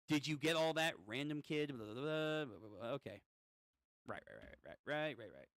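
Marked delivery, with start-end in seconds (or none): none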